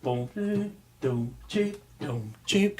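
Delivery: march-like bass sounds; oom-pah bass imitation. Shown as a demonstration. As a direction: mimic a stride left hand. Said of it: "playing the".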